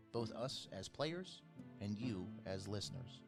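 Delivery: ordinary talk, nothing marked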